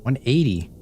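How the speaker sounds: ordinary talk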